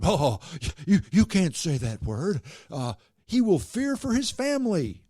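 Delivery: high, loud mocking whiny voice; mock the hand-wringers in a high whine; mocking the censors. Mocking objectors to naming the whistleblower, high whiny mimic. Mocking those who forbid the name.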